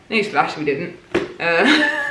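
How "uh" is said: high burst of laughter; laughing. Real, not acted. Laughing at own diary.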